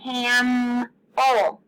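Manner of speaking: "Camel" drawn out on the M; long hummed middle in "Camel"; stretched-out consonant. Shown as a demonstration. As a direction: sustain the M in "Camel", cammm-el. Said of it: "If you said the M".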